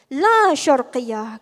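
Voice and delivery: drawn-out, forceful cry of refusal; emphatic loud rejection. Real, not acted. Speaker rejects the over-Eastern view before the audience, loud and emphatic. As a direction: call it out loud, stretching the first word.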